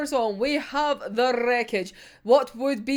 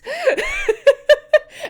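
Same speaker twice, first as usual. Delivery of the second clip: a loud, high burst of laughter; loud high giggle; 8 dB louder than usual. Real, not acted. Laughing at her own reaction.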